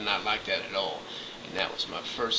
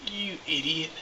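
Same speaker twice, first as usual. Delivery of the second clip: scoffing, raised and higher; exasperated retort; 8 semitones higher than usual. Retold or imitated. To the dispatcher about Alamos, exasperated and higher. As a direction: set the dispatcher straight, annoyed. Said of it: "And I'm like".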